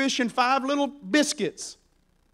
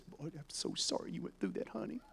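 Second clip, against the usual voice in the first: hushed, barely voiced fake comfort; mock sympathy; 18 dB quieter than usual. Jesus imagined consoling soaked Peter, falsely tender. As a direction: coo it very softly, pretending to console.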